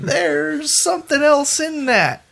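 mock horrified discovery; appalled. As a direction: stretch it out, recoiling from the smell. Retold disgust on smelling beer.

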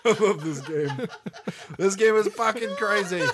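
high, real laughter through the words; giggly, delighted outburst; laughing. Real, not acted. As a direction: say it while cracking up. Cracking up after bad accent.

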